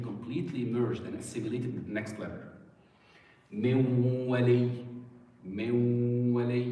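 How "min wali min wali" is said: "Min wali" with the nun melted into a long nasal w; time on the waw. Shown as a demonstration. Teacher asking where the time is spent, long nasal waw in "Min wali". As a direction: hold a humming w in "Min wali", slow and level. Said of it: "where am I spending my time?".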